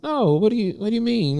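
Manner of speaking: high, loud pleading protest; alarmed protest. Retold or imitated. Son protesting to his crying mom, high and alarmed. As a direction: cry out in alarmed disbelief.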